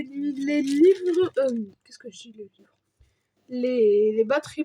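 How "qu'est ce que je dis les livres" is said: whispered self-doubting question; whispered to himself. Real, not acted. Unboxer whispers a puzzled question to himself. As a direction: breathe the words to yourself, puzzled.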